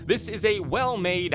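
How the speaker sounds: ordinary talk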